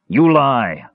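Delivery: narrated defiant retort; shot back. A narrator's reading. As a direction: shoot it back as an accusation. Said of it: "Risha shot back".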